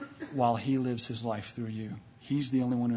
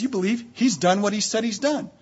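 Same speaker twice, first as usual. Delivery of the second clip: normal talking voice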